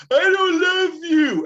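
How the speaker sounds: put-on high, loud, drawn-out kid wail; hammed-up bratty child; upset child. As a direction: whine it slowly, way up high. Child rejecting teacher, acted out.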